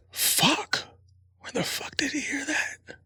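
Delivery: high breathy whisper under his breath; horrified high whisper; panicked whisper. Retold or imitated. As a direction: whisper in shocked panic so kids won't hear. Dad's panicked inner reaction.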